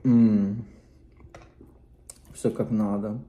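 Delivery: talking with mouth full; muffled between bites; chewing. Real, not acted. Seen 2: Bit food at -2s; lips pressed, jaw working through the words.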